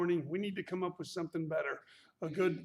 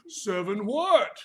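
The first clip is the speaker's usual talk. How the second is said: drawn-out, louder "Seven what?"; slow, puzzled "Seven what?" with a falling end; mock puzzled question; 9 dB louder, slower than usual. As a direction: act out a confused student asking what seven means. Mock puzzled student asking.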